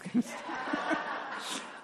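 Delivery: a light unvoiced laugh; amused breathy laugh. Real, not acted. Laughing at sweets for diabetic.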